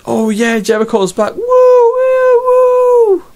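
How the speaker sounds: high-pitched cheering fanboy, whooping loud; excited fanboy. Retold or imitated. Mocking fans cheering Jericho's return, with a shrill loud whoop. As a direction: squeal like a giddy fanboy over the return. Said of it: "a fanboy going".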